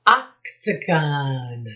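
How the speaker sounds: slow, clearly announced shape name. Shown as a demonstration. Teacher names a shape on the chart, slowly. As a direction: present the word slowly. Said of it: "Here is Missus B's shapes chart".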